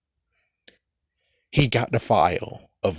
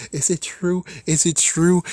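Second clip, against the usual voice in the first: higher-pitched mock pleading; pleading question; 7 semitones higher than usual. Reviewer imitating her asking if it's true, mock pleading.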